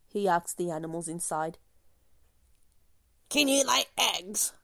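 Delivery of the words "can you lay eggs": clucking hen. A narrator's reading. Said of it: "clucked the hen".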